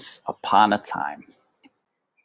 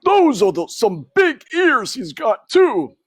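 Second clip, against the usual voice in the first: high, louder gossiping uncle mouse; Uncle Alfred; 6 dB louder than usual. A narrator's reading. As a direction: pipe up like a nosy old uncle. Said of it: "observed his Uncle Alfred".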